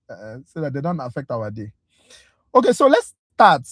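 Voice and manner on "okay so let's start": calling out. Real, not acted. Calling the class to start.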